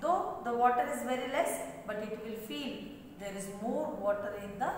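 usual speaking voice

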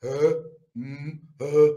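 low, dopey mock grunts; dumb onlooker. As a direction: grunt cluelessly, imitating a useless guard. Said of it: "guckt nur dumm rum".

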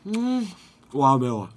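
talking while chewing spicy food; gasping with food in mouth; mouth full, chewing. Real, not acted. Seen 0: Took a bite just before; cheeks full, jaw chewing, then gasping.

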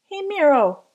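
"Miro" ending in O instead of A; a kid calling out "Miro" wrongly; name mispronounced. Acted. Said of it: "couldn't say it right".

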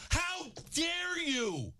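very slow, high, stretched yell; drawn-out furious shout. Real, not acted. Host repeating his outrage at the lineman, slow stretched yell. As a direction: drag every word out in outrage. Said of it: "that angers me".

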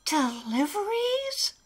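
high rising question; puzzled little character. Acted. Alistair questions the old elf's plan, puzzled. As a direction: echo the word in a small high voice.

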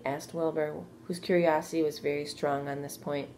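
ordinary delivery

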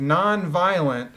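normal talking voice

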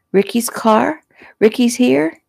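put-on a little girl caught off guard; surprised child. A narrator's reading.

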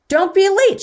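high, projected reminder; calling out. A narrator's reading. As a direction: call it to departing guests. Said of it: "she called".

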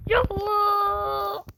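slow, high, drawn-out wailing cry; crying-out plea. Real, not acted. Gamer crying out to God mid-jump, wailing. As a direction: wail it to the sky in desperation. Pleading before a hard jump.

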